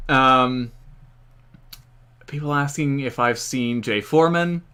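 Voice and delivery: ordinary talk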